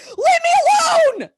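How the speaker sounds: very high, loud, stretched-out shriek; hammed-up yell. Rattled dad yelling at his daughter, over-the-top scream. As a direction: shriek it at full blast, drawn out. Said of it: "you fucking yell at me".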